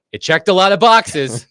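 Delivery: mock quoting. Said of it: "He mentioned".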